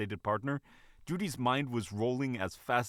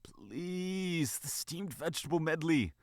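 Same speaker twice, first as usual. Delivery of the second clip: pleading; 7 semitones higher, slower than usual. Acted. Bunny begging the manager for food, pleadingly. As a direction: beg with a desperate high tone. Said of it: "She said pleadingly".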